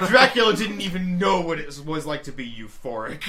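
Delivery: laughing through the mock line. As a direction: giggle as you say it. Mocking meme while laughing.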